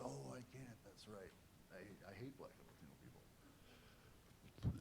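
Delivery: near-silent, slow inner voice; barely voiced self-reminder. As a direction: talk himself out of it under his breath.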